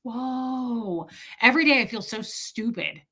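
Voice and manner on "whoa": soft, drawn-out whoa; a hushed, stunned whoa; mock amazement. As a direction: act wide-eyed and astonished. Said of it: "I'm like".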